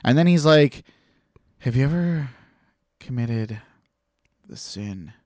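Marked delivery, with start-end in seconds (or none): 1.6-5.1 imitated priest, long pauses, sinking softer; hushed, hesitant confessor imitation; halting priest. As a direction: draw out the question piece by piece, voice dropping. Retold priest's halting, loaded question.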